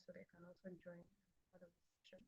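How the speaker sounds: faint, distant mic voice; words fading into nothing; barely audible. Real, not acted. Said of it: "your voice is very low".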